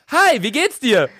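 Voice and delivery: a touch of a perky courier; imitating a cheery courier. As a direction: play the too-cheerful delivery guy. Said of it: "Er kommt so".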